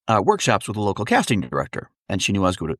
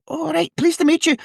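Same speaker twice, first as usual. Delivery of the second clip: put-on very high little-old-lady Scottish voice; imitated high-pitched Scottish mother; tiny Scottish mother. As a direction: greet brightly as a wee Scottish mum. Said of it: "She's like".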